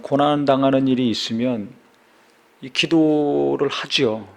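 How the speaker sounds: normal talking voice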